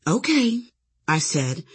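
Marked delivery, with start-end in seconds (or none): 0.1-0.6 small, higher-pitched timid okay; mock-meek little girlish voice; meek church girl. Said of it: "in the meekest little church girl voice in the world".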